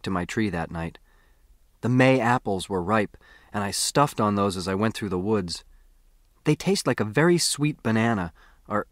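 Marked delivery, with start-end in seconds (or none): none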